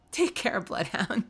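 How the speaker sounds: ordinary delivery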